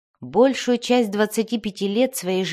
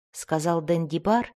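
usual speaking voice

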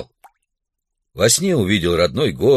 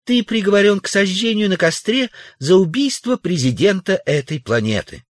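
very high female character voice; Тулия; 11 semitones higher than usual. A narrator's reading. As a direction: declare it in a high-pitched woman's voice. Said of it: "заявила Тулия". (заявляет высоким женским голосом)

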